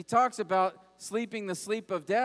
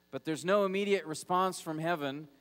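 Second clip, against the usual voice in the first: normal talking voice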